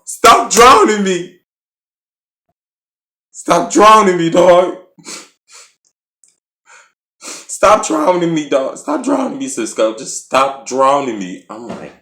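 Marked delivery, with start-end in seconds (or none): 0.0-1.3 emotional shout. Retold or imitated. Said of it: "this when Rich started getting emotional". 3.5-11.4 emotional shout. Retold or imitated. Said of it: "this when Rich started getting emotional".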